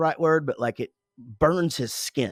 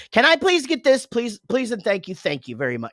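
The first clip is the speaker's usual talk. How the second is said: higher, louder gushing please; over-polite; 8 semitones higher, 5 dB louder than usual. Retold or imitated. Dad mocking his own overly polite requests. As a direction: lay the politeness on thick.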